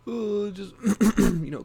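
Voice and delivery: high, loud fake wail; exaggerated crybaby sobbing, mocking; mock bawling. Mocking a former director crying on TV, whiny fake bawl. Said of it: "crying like a little bitch".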